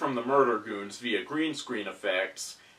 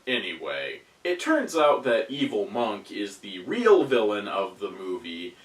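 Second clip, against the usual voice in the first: normal talking voice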